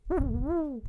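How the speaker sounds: high, long owl hoot; owl impression. Shown as a demonstration. Streamer showing viewers an owl impression, high hooting. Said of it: "I shall now do my owl impression".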